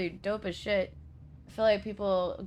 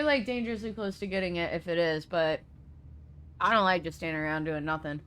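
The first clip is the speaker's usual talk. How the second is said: normal talking voice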